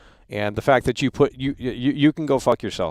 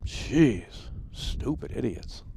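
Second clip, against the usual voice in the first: much softer, whispered aside; whispered disgust; 8 dB quieter, much slower than usual. Real, not acted. Streamer muttering about clout chasers, whispered contempt. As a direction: breathe it out in contempt.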